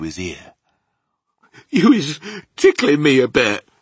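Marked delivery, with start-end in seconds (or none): 1.7-3.7 high, loud giant voice; squirming, raised-voice complaint; tickled giant. Acted.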